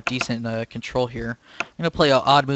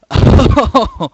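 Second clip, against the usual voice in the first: hysterical high laughter; 9 semitones higher, 12 dB louder than usual. Real, not acted. Still laughing after win.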